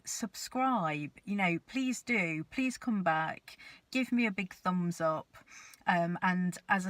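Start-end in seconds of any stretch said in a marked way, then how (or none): none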